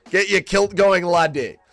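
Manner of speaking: loud, high mock Scottish war cry; Scottish accent. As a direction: shout it like a hammed-up Scottish rebel. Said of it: "say it with a Scottish accent".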